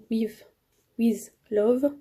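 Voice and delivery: stumbling, French-accented "with, with Love"; French-accented English. Shown as a demonstration. Vlogger fumbling the challenge's English name, French accent. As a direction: trip over "with" in a French accent. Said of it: "Il est là l'accent anglais".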